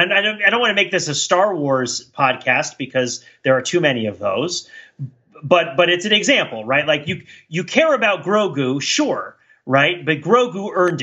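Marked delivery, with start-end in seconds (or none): none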